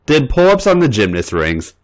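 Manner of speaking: usual speaking voice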